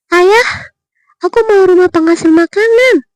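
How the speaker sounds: high, loud eager cry; the greedy eldest daughter blurting out her wish; eager exclaim. A narrator's reading. Eldest daughter calls out to her father, high and eager. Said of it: "seru putri pertama".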